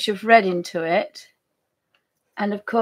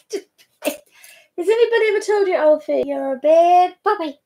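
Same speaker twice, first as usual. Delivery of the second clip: high, louder playful telling-off; mock scolding dog; 7 semitones higher, 6 dB louder than usual. Real, not acted. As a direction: tease the puppy.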